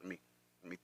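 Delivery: quiet, choked up, trying to hold it together; swallowing tears, voice dropping low and soft; fighting back tears. Real, not acted. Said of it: "I'm gonna start crying".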